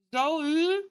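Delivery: high call through a door; muffled call. A narrator's reading. Rumi calling Zoe through the closed door, muffled. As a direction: call her name from behind the door. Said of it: "Rumi's muffled voice calls".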